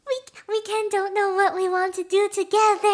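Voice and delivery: high, loud words through laughter; laughing joke. Real, not acted. Streamer joking back to a sad character, laughing through the words. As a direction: giggle out the joke.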